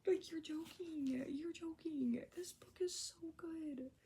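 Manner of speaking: high, whispered disbelief; breathless gushing. Real, not acted. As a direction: gasp it out, swept away. Gushing over the book.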